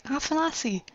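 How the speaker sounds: high, far-off call of a name; lightly voiced Adele calling out; calling Afanasi. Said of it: "Adele's like".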